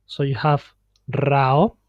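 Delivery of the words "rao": "rao" with a rolled opening R; "rao" as if written with two R's; multiple R. Shown as a demonstration. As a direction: trill the R at the start of "rao". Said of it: "but you hear two R's".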